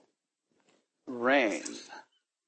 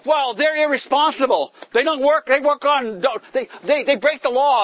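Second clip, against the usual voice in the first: mock scornful excuse; 9 semitones higher, 5 dB louder than usual. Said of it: "voicing employer's excuse".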